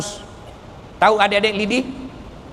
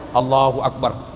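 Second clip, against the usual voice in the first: distracted prayer; 11 semitones lower than usual. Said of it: "Masih dicari tuh".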